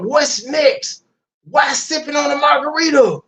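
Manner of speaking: imitazione canzonatoria, acuta; imitazione beffarda. Retold or imitated. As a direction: rifalle il verso alzando il tono. Mocking the woman's clip.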